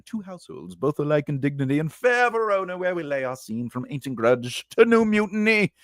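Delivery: hammed-up verse, swelling high on fair Verona; Shakespeare recital. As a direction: declaim it like a mock stage prologue. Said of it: "I did a Shakespeare play once".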